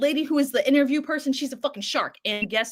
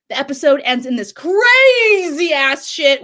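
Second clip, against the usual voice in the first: hyped shout; 3 semitones higher, 9 dB louder, slower than usual. Real, not acted. Hyping the crazy finale.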